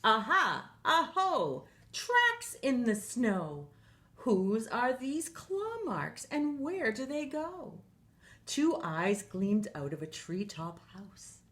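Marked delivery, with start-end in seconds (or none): 0.0-7.8 high, slow, thrilled "Aha!"; a little monster who just found a clue; excited discovery. Acted. Child finds tracks.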